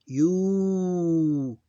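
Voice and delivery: yu stretched out long and high; drawn-out teaching yu. Shown as a demonstration. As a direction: hold the yu as a long vowel. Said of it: "the yu one become long".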